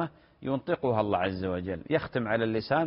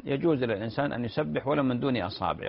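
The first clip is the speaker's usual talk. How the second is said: usual speaking voice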